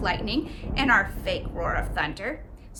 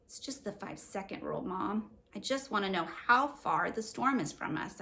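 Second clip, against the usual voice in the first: softer, mumbled to her mom; mumble; 2 semitones lower, 5 dB quieter than usual. Acted. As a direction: mumble it, mouth half closed. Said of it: "I mumble".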